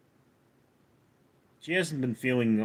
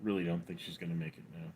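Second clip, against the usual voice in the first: much softer, fast deadpan murmur; softer and faster mock-sad repeat; mock grave hush; 8 semitones lower, 16 dB quieter, much faster than usual. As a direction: drop it to a hushed fake-solemn aside. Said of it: "I mean".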